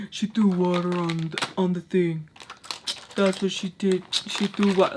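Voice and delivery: mock the background men echoing her; men parroting. Men in the back re-saying Tommy's words, dopey parroting. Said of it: "just re-saying what Tommy's saying".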